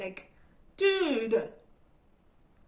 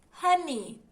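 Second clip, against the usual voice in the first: emphatic honey; 7 semitones higher, 8 dB louder than usual. Real, not acted. Addresses the viewer emphatically.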